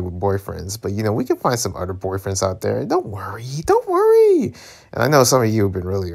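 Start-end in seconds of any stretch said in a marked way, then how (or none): 3.7-4.5 playful falsetto reassurance. Acted. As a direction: comfort them in a silly falsetto. Same words repeated in falsetto.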